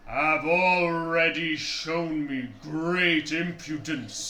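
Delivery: slow, loud booming giant; giant's echoing voice. Acted. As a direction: rumble it out slowly. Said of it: "echoes the voice throughout the canyon".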